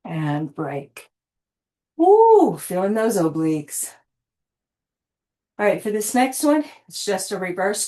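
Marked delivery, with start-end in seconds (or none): 2.0-2.6 a high, loud "woo" of effort; excited whoop. Real, not acted. Fitness coach whooping at her class mid-exercise, excited.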